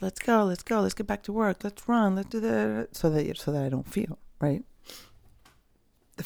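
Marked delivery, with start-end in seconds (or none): 0.0-3.0 higher, pushy hurry-up voice; hammed-up go-go-go; mock rushing self. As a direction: imitate your own restless drive. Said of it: "I tend to just".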